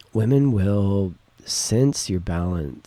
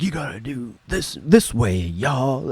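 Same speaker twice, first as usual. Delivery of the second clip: higher, louder bossy mock shout; mock yell; 6 semitones higher, 4 dB louder than usual. Showing men how an all-warrior guy yells, mock bossy shout. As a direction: bark orders like a drill sergeant, half-playing. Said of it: "I'd be yelling at you".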